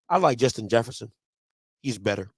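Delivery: low, unbothered retort; deadpan indifference. Retold or imitated. Daughter brushing off dad's challenge, deadpan. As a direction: answer softly, totally unimpressed.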